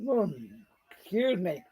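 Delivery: talking through a yawn; stifle a yawn mid-word. Real, not acted. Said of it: "Trying not to yawn in public".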